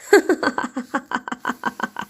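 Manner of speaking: long giggling laugh trailing off; a run of quick ha-ha laughs; laughter. Acted. Sister-in-law sees the funny little cap on her, long laugh.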